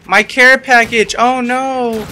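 high, loud, slow anguished wail. Real, not acted. Gamer mourning his care package, high loud wail. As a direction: draw out the wail of loss.

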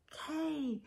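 plain reading voice

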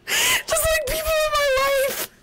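very high squeaky little voice. Pretend. Vlogger jokes about who watches her videos, very high squeak. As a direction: squeak it out playfully.

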